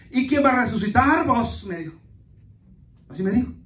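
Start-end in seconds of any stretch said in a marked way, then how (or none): 0.1-1.7 raised, mock-sceptical question. Church brother scoffs at the resurrection service, mockingly. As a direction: imitate a scoffer's jeering question. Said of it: "lo dijo bien burlonamente".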